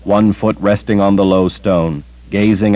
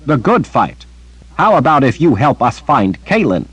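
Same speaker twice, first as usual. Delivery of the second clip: higher, scornful echo of his words; sharp, exasperated sorceress; Anne incredulous; 7 semitones higher than usual. Acted. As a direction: throw his phrase back at him in disbelief. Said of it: "It means I will fight the good fight".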